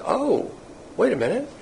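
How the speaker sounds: acted dawning realization, high and soft; mildly acted second thought, lifted high; sudden realization. Acts out David's sudden realization.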